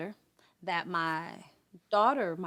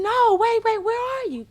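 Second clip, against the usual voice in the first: desperate plea; 11 dB louder than usual. Retold or imitated. Mother begging on the phone.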